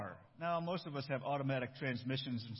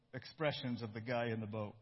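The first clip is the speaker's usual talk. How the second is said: ordinary talk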